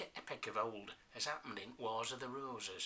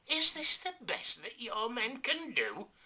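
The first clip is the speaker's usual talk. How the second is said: scornful prince; 10 semitones higher, slower than usual. Acted. Prince scolding his men.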